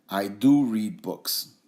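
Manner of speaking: "do" stressed, said slowly; emphatic do. Shown as a demonstration. Teacher modelling the stressed "do". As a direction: hit "do" to affirm. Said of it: "we wanna emphasize that this action does happen".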